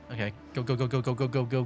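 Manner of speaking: ordinary talk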